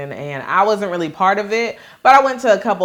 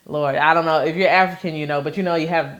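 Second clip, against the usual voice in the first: usual speaking voice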